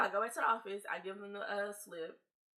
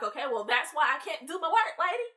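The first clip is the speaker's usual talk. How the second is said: louder, higher fed-up retort; exasperated sass; 7 semitones higher, 8 dB louder than usual. Retold or imitated. Student snapping at the teacher, exasperated and sassy. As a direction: throw it back at her with attitude.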